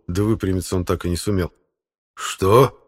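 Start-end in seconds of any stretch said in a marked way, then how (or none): 2.1-2.8 loud, high startled question; surprised. Acted. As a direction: ask in sudden surprise. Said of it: "удивился Фёдор Игнатьевич". (удивлённо, громко)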